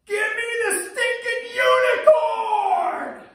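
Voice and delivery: slow, very high, loud fed-up scream; a mom at her boiling point; furious yell. Retold or imitated. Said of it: "so she just yelled".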